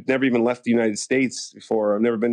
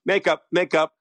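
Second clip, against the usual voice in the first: higher-pitched mock call to the crew; calling for makeup; 7 semitones higher than usual. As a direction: imitate a brisk call for service. Co-star calls for makeup crew.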